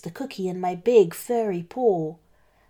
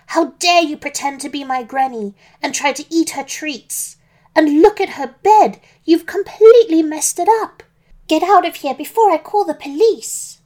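put-on high, scolding girl's outburst, growing louder; angry shout; 7 semitones higher, 7 dB louder than usual. A narrator's reading. Little Red throwing out the fake Granny, shouting angrily. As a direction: tell him off, building to a loud shout. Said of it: "she shouted angrily".

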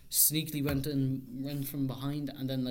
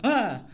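mock scare shout; 6 semitones higher, 6 dB louder than usual. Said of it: "And you just went".

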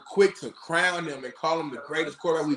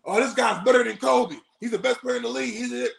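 high shouting fan hype; mock hyped fan; 7 semitones higher, faster than usual. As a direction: yell like an overeager fan. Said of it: "fans just from year two".

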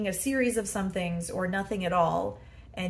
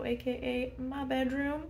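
normal talking voice

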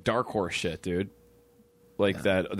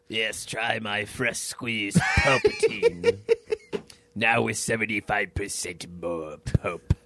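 hammed-up ad pitch for fresh squeezed Palpatine, slow with laughter; mock juice ad; 2 semitones lower than usual. Friend jokes about Palpatine as orange juice, in a laughing mock ad voice.

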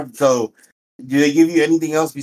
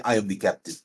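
deadpan captain line; 4 semitones lower, 7 dB quieter than usual. Shown as a demonstration. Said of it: "just say".

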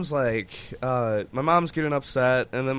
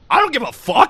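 sister's outburst; 11 semitones higher, 8 dB louder than usual. Retold or imitated. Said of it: "She just goes".

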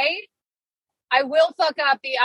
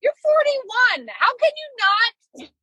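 scolding herself; 7 semitones higher than usual. Retold or imitated. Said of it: "so mad at myself".

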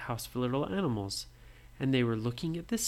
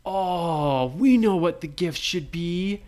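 higher, louder eager voice; excited brothers; 6 semitones higher, 7 dB louder than usual. Acted. Brothers telling Nora they know the gifts, eager. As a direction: burst with a sudden idea. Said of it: "they said".

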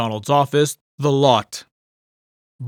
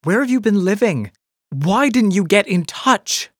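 bitter; 8 semitones higher, 4 dB louder than usual. A narrator's reading. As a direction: let the hurt leak into each word. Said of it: "The bitterness was impossible to hide".

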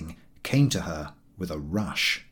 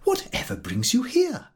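surprised. A narrator's reading. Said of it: "exclaimed Missus Y".

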